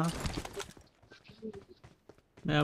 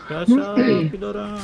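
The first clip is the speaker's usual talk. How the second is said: calling out; 6 semitones higher, 9 dB louder than usual. Real, not acted. Calling louder for the missing teammate.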